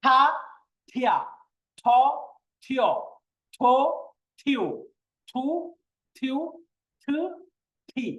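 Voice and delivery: each syllable from থা to থি with a strong puff after "t"; forceful aspiration. Shown as a demonstration. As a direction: push air hard through every থ, one by one. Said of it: "বেশি pressure দিতে হবে".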